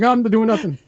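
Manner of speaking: put-on a kid hiding the game; guilty kid. Host mocks the son caught playing, squeaky guilty denial. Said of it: "did he throw it in the corner like".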